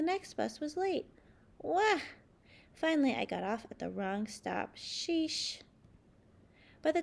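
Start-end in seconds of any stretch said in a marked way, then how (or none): 1.6-2.0 high loud wah; a cartoon wail of dismay; frustrated wail. Grape missing the bus, whining wail. 4.8-5.6 exasperated sheesh. Acted. Wrong stop.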